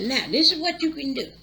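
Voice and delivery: lightly voiced father laying down the law; stern father's order. Father telling the poisoned man his choices, lightly stern order.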